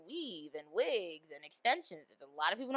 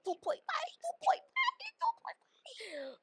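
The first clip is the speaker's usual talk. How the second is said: very high, very slow stretched count; drawn-out squeak; much slower than usual. Pretend. Joking about her own delay, very high and slow.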